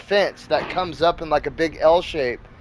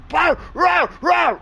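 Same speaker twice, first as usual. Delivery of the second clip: frantic high-pitched dog barks; imitated pug barking; 12 semitones higher, slower than usual. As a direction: bark like a worked-up pug. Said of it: "our pug started barking too".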